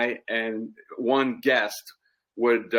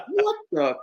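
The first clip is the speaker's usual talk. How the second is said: high disbelieving yelp; shocked at being woken; incredulous; 13 semitones higher than usual. Retold or imitated. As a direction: woken by a yell, baffled. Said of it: "I'm like".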